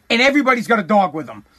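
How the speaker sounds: normal talking voice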